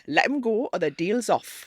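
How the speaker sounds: lightly voiced Spuggy in Geordie; Spuggy impression. Shown as a demonstration. Host does Spuggy from Byker Grove when asked. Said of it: "I can do Spuggy from Biker Grove".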